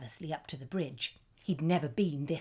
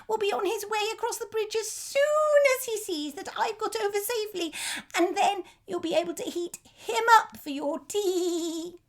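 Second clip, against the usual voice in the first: very high, louder squeaky goat voice; 7 dB louder than usual. A narrator's reading. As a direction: wheedle like a scared little goat. Goat bargains with troll.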